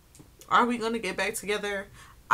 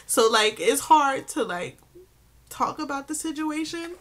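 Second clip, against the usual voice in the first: higher, louder, choked-up crying voice; 5 semitones higher, 5 dB louder than usual. Real, not acted. Telling viewers how hard David is to talk about, crying. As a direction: push the words out through sobs. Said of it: "I just started crying".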